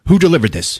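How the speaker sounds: high, urgent questioning; mock paranoid interrogation; suspicious demand. Stone to the cops about the cooler, suspicious demand.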